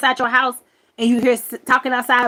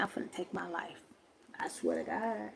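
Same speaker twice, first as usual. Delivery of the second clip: quiet; 3 semitones lower, 16 dB quieter than usual. Retold or imitated. Dramatic quiet despair.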